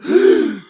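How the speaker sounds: loud high shocked gasp; sharp shocked gasp; class gasp. Acted. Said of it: "The classroom gasped".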